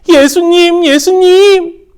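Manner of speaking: high, loud, slow happy call; joyful calling. Acted. Bartimaeus standing before Jesus, calling him joyfully. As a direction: call his name with joy. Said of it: "기쁜 마음으로 예수님을 불렀어요".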